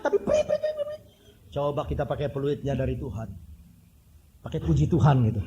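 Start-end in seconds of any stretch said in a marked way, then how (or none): 0.2-1.0 very high, shrill whistle blasts; mock whistle blasts from a traffic cop; police whistle imitation. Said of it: "Mungkin mau dia".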